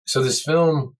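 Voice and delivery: talking while chewing gum; words around a wad of gum. Real, not acted. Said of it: "sorry, I'm chewing gum".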